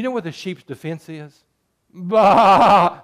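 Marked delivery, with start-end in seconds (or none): 1.9-3.0 loud, high mock baa; sheep bleat. Preacher mimicking a sheep's only defense, loud bleat.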